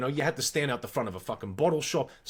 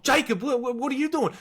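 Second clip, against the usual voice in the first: imitating panicked girl; 8 semitones higher than usual. Girlfriend panicked on phone, retold.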